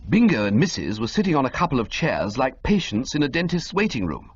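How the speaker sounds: ordinary delivery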